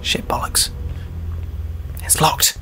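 under-the-breath whispered swearing; frustrated whisper at a locked door; whispered cursing. Real, not acted. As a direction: hiss the curses quietly to yourself. Frustrated muttered curse at lock.

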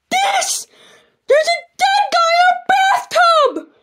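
very high, slow, horrified cry; terrified shriek. Acted. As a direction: point and scream in horror. Jeffy shows the dead body.

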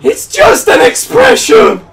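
very high, loud screech; over-the-top defensive yell; exasperated scream. Pretend. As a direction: shriek it in comic exasperation.

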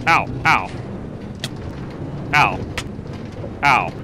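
ordinary talk

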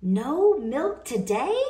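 loud, slow, high shocked cry; a farmer crying out in disbelief; cried out. Acted. Farmer reading the cows' note, crying out loud. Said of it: "cried Farmer Brown".

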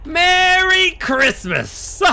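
high, drawn-out shout; loud holiday bellow. Real, not acted. Player yelling Merry Christmas to the crew, loud and drawn out. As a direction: bellow it long and high with glee. Bellowed festive outburst in game.

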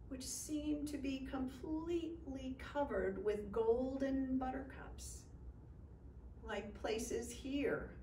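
plain reading voice

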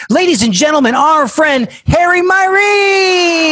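high, drawn-out announcer shout; ring announcer introduction. Pretend. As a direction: belt out the guest's name, stretching the last syllable. Host announces the guest.

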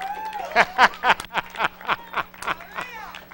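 ringing delighted laugh; joyful high laugh. Real, not acted. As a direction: burst out laughing with joy. Joyful laugh after the quote.

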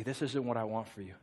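low, very soft voice; hushed gentle correction. Retold or imitated. God redirecting someone through messengers, low and hushed. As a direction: redirect gently, almost under the breath.

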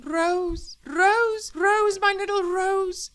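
very high, loud, drawn-out endearments; overcome with affection. Acted. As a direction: croon her name in rapturous relief. Husband's emotional reunion with wife.

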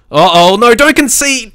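sudden high, loud alarm; panicked plea. Real, not acted. Gamer panicking as the rival attacks, high alarm. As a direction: beg the defence not to let it in.

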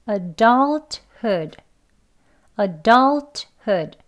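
adulthood with DULT drawn out and punched; stress on second syllable. Shown as a demonstration. Teacher showing learners the stressed DULT. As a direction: lean hard on the DULT of adulthood. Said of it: "adult를 강하게 높게 길게 합니다".

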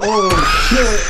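sudden frightened yell; startled shout. Real, not acted. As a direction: jump at the scare. Jump scare in horror game.

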